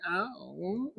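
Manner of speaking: put-on tiny, very soft baby-voice sound; light, badly done baby voice; Paris Hilton baby voice. Shown as a demonstration. Showing viewers how some women put on a voice, attempted baby voice. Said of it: "like that baby voice, like the Paris Hilton voice".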